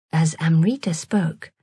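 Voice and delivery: ordinary delivery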